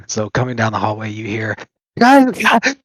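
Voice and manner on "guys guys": high loud call. Acted. Goblin calling its friends down the hallway, loud. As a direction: shout down the hallway for help.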